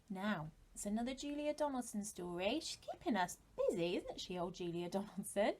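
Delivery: ordinary delivery, nothing marked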